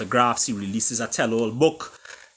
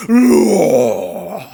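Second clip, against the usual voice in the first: big roaring cry, louder and higher; distant lion roar; 8 semitones higher, 11 dB louder than usual. Acted. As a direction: let out a tiger's battle roar. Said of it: "they heard the familiar roar".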